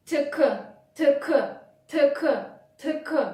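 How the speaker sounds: crisp T K repeated; consonant drill. Shown as a demonstration. Teacher drilling a consonant cluster for learners, crisp. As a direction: tap out T K, T K. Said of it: "combination of two consonants".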